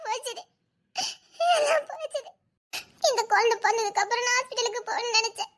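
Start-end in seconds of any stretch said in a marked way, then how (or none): 0.1-2.3 higher, sobbing laugh over lost hopes; crying lament. Acted. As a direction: wail it through tears. Wife lamenting lost career. (அழுகையுடன் புலம்பல்)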